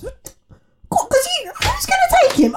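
very high mocking squeal; incredulous squeal. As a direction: squeal it in mock disbelief. Mocking friend's belief he wants her.

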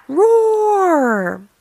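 loud, long roar starting high and falling; mighty storybook roar. A narrator's reading. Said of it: "let out a mighty".

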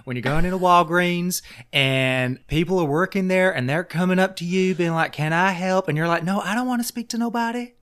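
high, fast mimicry of a fed-up Oklahoma lady; mock Oklahoma woman ranting. Podcast host griping about Walgreens staff, high fast mock rant. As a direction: squeal it out like a grumpy shopper hiding in Walgreens. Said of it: "then they're like".

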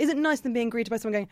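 ordinary talk

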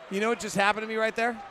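normal talking voice

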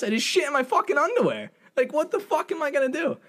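high, squeaky panic; high panicked squeal; mock panic. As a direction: voice jumps up in distress. Panicked inner quote.

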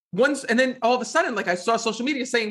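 ordinary delivery

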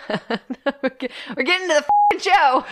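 can't stop laughing while quoting; giggling through the line. Real, not acted. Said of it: "laughing repeat".

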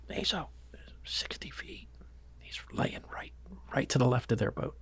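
hushed whisper so the fish won't spook. Retold or imitated. Whispering the fish's spot to John on the boat. Telling friend where fish lies.

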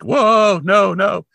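high, loud "whoa" of dismay; recoiling protest. Retold or imitated. Recalling her reaction to the accent, high mock-appalled protest. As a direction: balk loudly, pitch jumping up. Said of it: "I was just".

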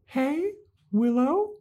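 high, slow mock-soothing voice; placating Buffy. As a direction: coax a furious friend in a high, sugary, drawn-out voice. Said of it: "And Buffy's like".

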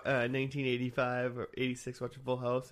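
ordinary talk, nothing marked

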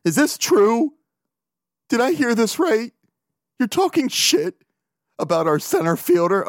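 high-pitched, incredulous aunt imitation; disgusted aunt. Aunt phoning her nephew about his center fielder talk, high-pitched disgust. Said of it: "they're disgusted with me".

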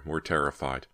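plain reading voice

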